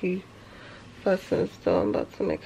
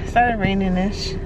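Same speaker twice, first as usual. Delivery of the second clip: ordinary talk, nothing marked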